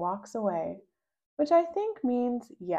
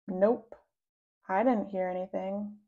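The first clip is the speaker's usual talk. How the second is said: usual speaking voice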